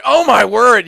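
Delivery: loud, high mock exclamation; hammed-up high cartoon voice; Stewie. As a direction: exclaim like a cartoon character, pitched right up. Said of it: "trying to do a Stewie impression".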